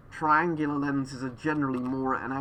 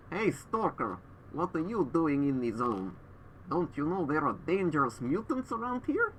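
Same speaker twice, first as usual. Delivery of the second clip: put-on hammy Russian accent, raised pitch; fake Russian accent; 4 semitones higher than usual. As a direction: play a Stalker NPC in fake Russian accent. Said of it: "a really fake Russian accent while wearing a mask".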